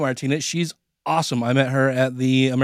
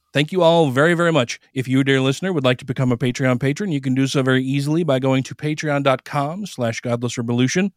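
ordinary talk

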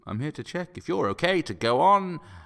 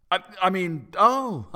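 flustered delight; 7 semitones higher, 7 dB louder than usual. Acted.

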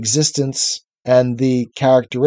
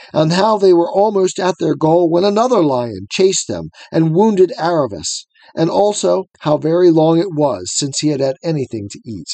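normal talking voice

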